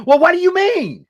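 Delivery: high, loud confrontational outburst; voice shooting up octaves. Acted. Someone reacting to confrontational talk, voice shooting up high and loud. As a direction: jump way up in pitch and volume, indignant. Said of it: "their voice will go up one".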